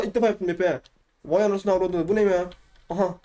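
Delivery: ordinary talk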